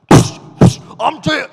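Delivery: loud mock shout after hissed sound effects; brash best friend. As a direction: burst in like an overfamiliar buddy. Acts the barging-in best friend.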